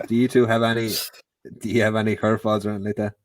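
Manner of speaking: ordinary talk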